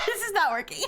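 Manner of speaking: high-pitched, through real laughter; words breaking up with giggles; laughing helplessly. Real, not acted. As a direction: crack up while talking. Cracking up at failed accent.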